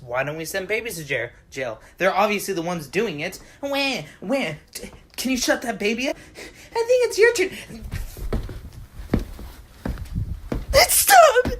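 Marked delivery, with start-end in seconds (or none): 3.6-4.5 high-pitched fake crying; two short imitated baby wails; mock baby wail. Imitating the baby in a household quarrel, mock wail. 4.7-11.5 high-pitched squabble rising to a yell; acted parental quarrel. Couple fighting over crying baby, escalating mock quarrel.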